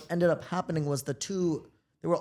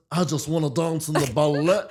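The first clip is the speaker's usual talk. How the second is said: louder, put-on voice; imitating Kim; 8 dB louder than usual. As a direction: quote the character loudly. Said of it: "where Kim goes".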